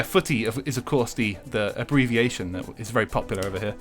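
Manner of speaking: ordinary talk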